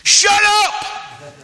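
very loud, high shout; blunt shouted rebuke. Acted. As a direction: bellow it to silence someone. Acts the crowd's blunt version.

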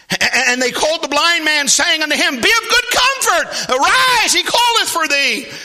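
high, loud proclamation of scripture; full-force preaching. Acted. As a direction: belt out the summons to the congregation.

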